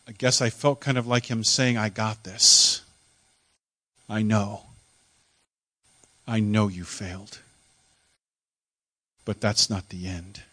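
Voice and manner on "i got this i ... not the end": Jesus forgiving the disciple, gently reassuring; gentle reassurance. Acted. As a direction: reassure a friend who failed. Imagined Jesus comforting him.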